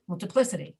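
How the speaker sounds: "multiplicity" quickly, first syllables swallowed to "muhl-tuh"; reduced "uh". Shown as a demonstration. Teacher showing it stays understandable, "multiplicity" with reduced vowels. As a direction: say "multiplicity" as m'l-tuh-PLIS-uh-tee. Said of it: "but it's a reduced "uh".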